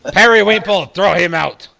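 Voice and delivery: loud, high shout to a henchman; calling out orders. Acted. Shopkeeper calling Periwinkle to throw someone out, loud order. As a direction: holler for the bouncer. Shopkeeper calls his bouncer.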